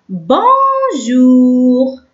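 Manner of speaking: بونجور very slowly, both syllables held long; drawn-out syllables. Shown as a demonstration. Teacher introducing a French word to pupils, drawn-out syllables. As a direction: hold each half of بونجور. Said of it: "ننطقه اون".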